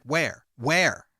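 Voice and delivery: mildly exasperated officer, retold; insistent questioning. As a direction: press for an answer, word by word. Retold officer demanding a location.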